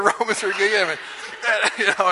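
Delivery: normal talking voice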